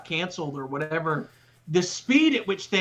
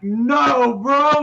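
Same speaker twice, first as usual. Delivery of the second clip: mock shout; 8 semitones higher, 8 dB louder than usual. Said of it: "you're like".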